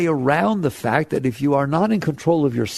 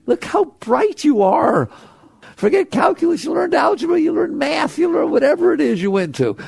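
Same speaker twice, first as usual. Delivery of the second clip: excited praise; 13 semitones higher than usual. Real, not acted. Teacher praises the audience's mind, excited. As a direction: lift them up with your voice.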